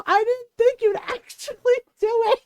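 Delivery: high-pitched, amused retold cry; laughing disbelief. Friend to cousin after the prank backfires, incredulous. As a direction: imitate a friend cracking up in disbelief. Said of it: "my cousin's friends are laughing".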